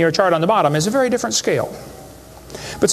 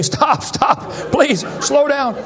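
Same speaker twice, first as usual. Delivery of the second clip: higher mock shout, stretched slow on the plea; imitated panicked shout, slow down drawn out; kids screaming stop; 7 semitones higher, much slower than usual. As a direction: beg like a terrified kid on a ride. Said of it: "now they're screaming".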